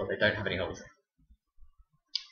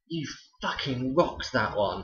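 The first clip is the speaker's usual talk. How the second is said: put-on drunk pupil; 4 semitones higher, slower than usual. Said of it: "was like".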